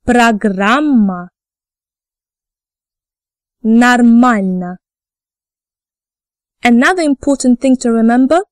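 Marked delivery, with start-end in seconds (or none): 0.1-1.3 slow "Программа", O said as A: pra-GRAM-ma; unstressed O as A. Shown as a demonstration. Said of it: "when the O sound in a word is not stressed". 3.6-4.8 slow "Нормально", both O's said as A: nar-MAL-na; unstressed O as A. Shown as a demonstration. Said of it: "when the O sound in a word is not stressed".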